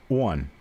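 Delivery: "one" with a w glide, like won; on pronounced like the number one. Shown as a demonstration. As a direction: say "one" for on, Appalachian style. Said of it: "It's almost like it's W O N".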